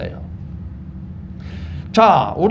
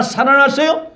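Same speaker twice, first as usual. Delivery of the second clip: ordinary talk, nothing marked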